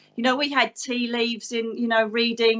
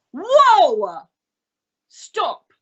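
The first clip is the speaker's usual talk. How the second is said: loud high halt, then firm stop; retold outburst calling a halt; feisty command; 7 semitones higher, 6 dB louder than usual. Medium silencing everyone in church, feisty shouted halt. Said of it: "real feisty when I need to be".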